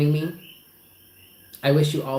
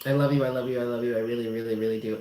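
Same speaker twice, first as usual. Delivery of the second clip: softer and lower, tender love; tender fading; 6 semitones lower, 5 dB quieter, faster than usual. Real, not acted. Comforting a grieving viewer, fading tender love. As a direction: let it fade into a murmur.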